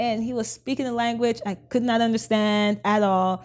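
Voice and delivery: normal talking voice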